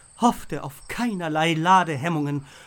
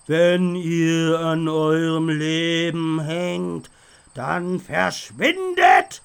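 slow, louder, hollow-sounding threat; the haunted armour warns the intruders to vanish, slow and menacing; ghost knight threat; 7 dB louder, much slower than usual. A narrator's reading. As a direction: menace them slowly from inside the helmet.

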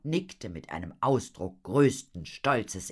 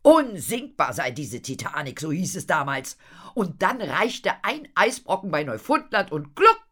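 loud, raised, mildly indignant voice; scornful retelling; 9 semitones higher, 9 dB louder than usual. Acted. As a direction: scoff at the empty promise. Madame scoffs at the Titanic. (höhnisch, laut und erhöht)

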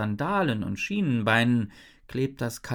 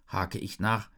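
ordinary delivery, nothing marked

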